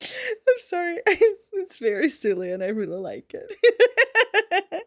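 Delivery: giggling through the words; breaking up with laughter; laughing to tears. Real, not acted. Laughing at own impression.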